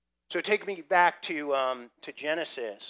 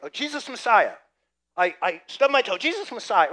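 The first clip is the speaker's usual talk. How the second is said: mock pained outburst; 5 semitones higher, 8 dB louder than usual. Mimics people after stubbing toe.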